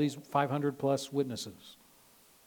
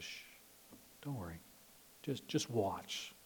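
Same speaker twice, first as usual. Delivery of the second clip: soothing hush; 3 semitones lower, 10 dB quieter than usual. Retold or imitated. Moses calms the people.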